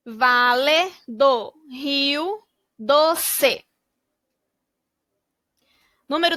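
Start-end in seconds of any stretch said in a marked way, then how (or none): 0.1-3.7 very slow "Vale do Rio Doce", vowels drawn out; slow stretched reading. Shown as a demonstration. Teacher blends the region name slowly for kids. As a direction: stretch every syllable so kids can follow. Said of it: "blending syllables into the word".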